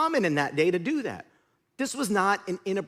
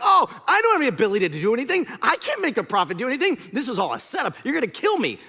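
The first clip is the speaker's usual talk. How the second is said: mock-panicked king, louder and higher; terrified; 6 semitones higher, 5 dB louder, faster than usual. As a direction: play a frightened king protesting in a fluster. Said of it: "the king of Israel is terrified".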